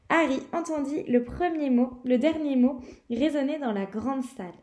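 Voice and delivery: usual speaking voice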